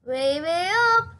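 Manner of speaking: plain reading voice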